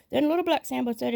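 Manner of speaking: ordinary delivery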